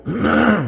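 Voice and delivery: sharp throat clear. Real, not acted.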